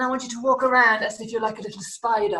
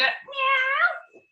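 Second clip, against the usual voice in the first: high, stretched miaow; long high cat cry; cat meow; 11 semitones higher, 3 dB quieter than usual. Shown as a demonstration. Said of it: "from the cat, you go".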